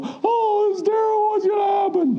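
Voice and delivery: high shrieking mock panic; panicky wail. Preacher mocks an emotional panic, high shrieking wail. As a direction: shriek it like a hand-wringing worrier.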